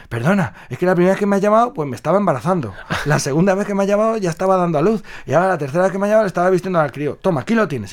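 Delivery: fast, high babbling excuse; rushed excuse. Acted. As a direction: gabble the excuse breathlessly. Spirit explains in a rush.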